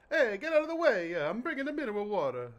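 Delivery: high, hammed-up Italian street shout; mock Italian accent. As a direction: yell it like an Italian driver waving people aside. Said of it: "That's how Italians in Italy talk".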